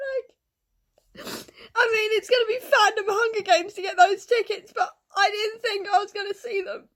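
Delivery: high, loud, tearful cries of happiness; real joyful sobbing that breaks into shouts. Real, not acted. Fan overwhelmed that her band returns, sobbing happily. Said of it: "Hence the tears".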